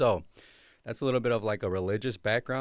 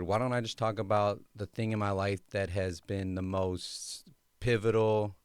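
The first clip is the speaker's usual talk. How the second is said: normal talking voice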